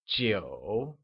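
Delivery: Jiu dipping low, then rising again; falling rising tone. Shown as a demonstration. Teacher modelling Jiu with a low dipping tone for learners. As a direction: let Jiu sink and curl back up. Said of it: "pronounced with a falling, rising tone".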